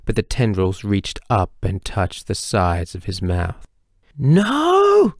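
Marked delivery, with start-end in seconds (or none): 4.2-5.1 high, loud desperate scream; yelled. A narrator's reading. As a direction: scream it in terror. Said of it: "he yelled".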